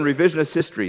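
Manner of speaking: ordinary talk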